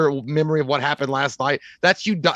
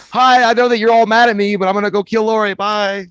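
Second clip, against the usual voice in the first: high, loud cheery goodbye; bright loud mock greeting, higher; mock villain farewell; 5 semitones higher, 5 dB louder than usual. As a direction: chirp it like a cheerful psycho. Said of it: "And he's like".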